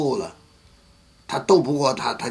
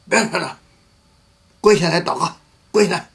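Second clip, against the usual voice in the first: louder, higher commanding shout; angry command; 6 semitones higher, 5 dB louder, faster than usual. Retold or imitated. Pastor losing his temper at the quarreling couple, shouting. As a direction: order them to kneel, fed up. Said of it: "我也火了".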